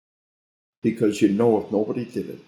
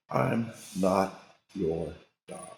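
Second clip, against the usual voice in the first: slow, much softer, deliberate refusal; calm defiance; 2 semitones lower, 8 dB quieter, much slower than usual. Acted. A sheepdog facing the evil one, slow quiet defiance. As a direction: lay each word down slowly, quietly unafraid.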